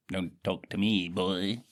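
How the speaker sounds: normal talking voice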